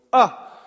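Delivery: short, high call into a cave; shouted "ah". Shown as a demonstration. Preacher showing congregation how caves echo, shouted "ah". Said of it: "where you can go".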